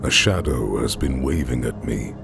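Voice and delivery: ordinary delivery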